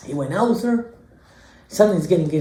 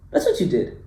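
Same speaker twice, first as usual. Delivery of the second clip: usual speaking voice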